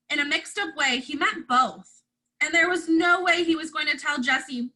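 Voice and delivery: ordinary delivery, nothing marked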